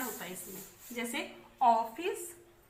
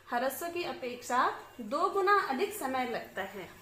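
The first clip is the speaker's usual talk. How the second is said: ordinary delivery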